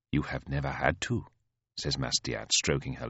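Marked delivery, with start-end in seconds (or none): 0.1-1.3 low, soft retort; unruffled elder sister. Acted. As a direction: put the sister down quietly.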